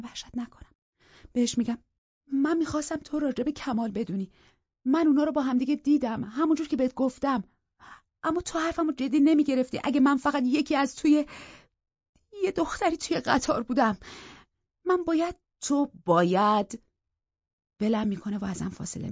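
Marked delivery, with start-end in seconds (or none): 2.3-15.3 much higher, urgent explanation; tense explaining. Acted. Rachel explains, scared. 15.6-16.8 very slow, sneering echo; mocking repeat. Scott mocks her words.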